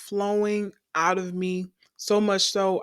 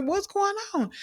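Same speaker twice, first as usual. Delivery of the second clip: high-pitched, worried question; kinda afraid; 14 semitones higher than usual. Retold or imitated. Mom answering the phone, imitated as kinda afraid. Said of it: "she's kinda afraid".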